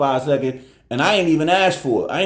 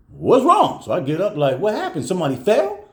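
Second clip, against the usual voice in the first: higher, startled questions; alarmed; 6 semitones higher than usual. Retold or imitated. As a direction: jump up in alarm at the noise. Alarmed dad jumps up.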